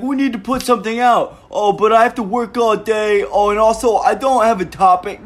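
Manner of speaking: high, whiny mimicry of the friend; mocking whiny friend. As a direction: whine it like a lazy excuse-maker. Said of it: "he's like".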